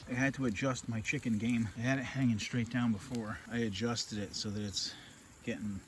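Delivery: ordinary delivery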